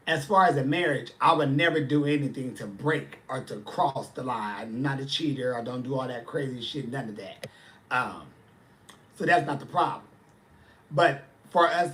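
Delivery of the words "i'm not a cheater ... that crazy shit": ordinary talk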